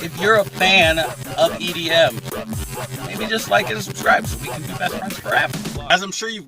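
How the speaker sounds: high, upbeat YouTuber intro; vlog intro. Acted. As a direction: hype up the viewers. Excited vlog intro over music.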